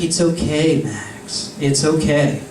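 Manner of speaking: low, gentle reassurance; calm, hushed mothering voice; soothing water mother. Acted. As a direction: comfort tenderly, dropping to a hush on the name. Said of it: "Water mother just continues spouting".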